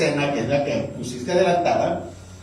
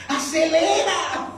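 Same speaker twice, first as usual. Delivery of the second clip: pleading; 10 semitones higher, much slower than usual. Retold or imitated. Urging taxi.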